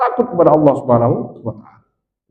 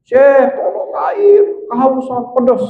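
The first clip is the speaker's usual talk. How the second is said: high, drawn-out shout for water; acted cry for help; 10 semitones higher, slower than usual. Thirsty guest shouts to the sheikh behind a locked door, drawn-out cry for help. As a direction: call out in long, parched wails. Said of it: "Teriak-teriak dia".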